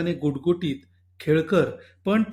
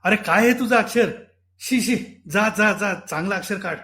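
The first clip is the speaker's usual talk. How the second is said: sudden loud scolding shout; 3 semitones higher, 4 dB louder than usual. Acted. Father to little son about handwriting, loud scolding shout. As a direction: yell in disgust at the slate. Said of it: "एकदमच हे ओरडले".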